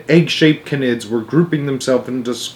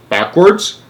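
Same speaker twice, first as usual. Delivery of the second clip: high rising query; startled cry; 6 semitones higher than usual. A narrator's reading. President hearing of the odd attack, crying out. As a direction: echo it in disbelief. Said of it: "cried the president".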